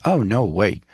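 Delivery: ordinary talk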